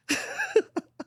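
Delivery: tiny falsetto giggle; a soft squeaky laugh; high squeaky giggle. Real, not acted. Laughs after own joke.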